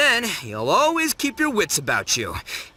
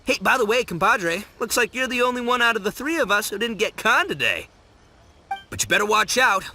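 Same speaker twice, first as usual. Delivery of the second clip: usual speaking voice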